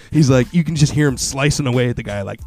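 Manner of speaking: ordinary talk, nothing marked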